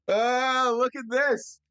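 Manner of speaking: showing off the gift, still buzzing; excited. Real, not acted.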